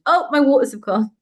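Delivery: retold scream. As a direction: call out the news in a burst. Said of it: "I scream to my husband".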